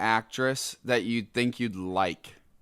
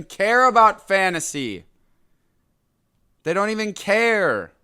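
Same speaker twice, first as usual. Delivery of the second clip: high, loud indignant outburst; exasperated, voice shooting up; mad; 8 semitones higher, 4 dB louder than usual. Real, not acted. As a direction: hit CARE high in outraged disbelief. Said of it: "it makes me so mad".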